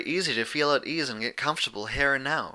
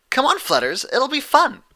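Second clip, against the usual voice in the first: very high and loud coaxing; giggly Pinkie; 7 dB louder than usual. Acted. Pinkie urging Fluttershy to join, high and giggly. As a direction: coax her with bubbly glee. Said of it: "Pinkie giggled".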